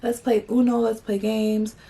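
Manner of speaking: high, drawn-out pleading guys; imitating guys begging them to stay; mock begging. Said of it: "they're, like, begging us".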